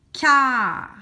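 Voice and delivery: CAR loud and drawn out; stretched syllable. Shown as a demonstration. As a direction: stretch the single syllable CAR so every sound is heard. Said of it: "Première syllabe".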